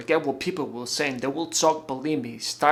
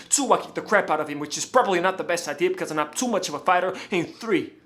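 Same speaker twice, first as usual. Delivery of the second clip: loud, high, rapid-fire listing; worked-up and fast, barely pausing; agitated ultimatum; 3 semitones higher, 4 dB louder, faster than usual. Acted. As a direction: rattle off the options in a heated rush. Confronting fiancée, listing threats.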